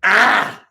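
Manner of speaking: short, high, sharp cry; queen's power shriek. Shown as a demonstration. Storyteller demonstrating a flawless queen's move, camp shriek. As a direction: let out one sudden high camp yelp. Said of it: "the most powerful move on a flawless queen is this".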